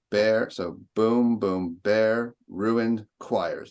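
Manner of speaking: slow, bare, ruined and choirs each hit; three stresses. Shown as a demonstration. Teacher counting three stresses in bare ruined choirs. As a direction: beat out each stressed syllable. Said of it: "That's three right there".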